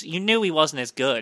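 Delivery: ordinary delivery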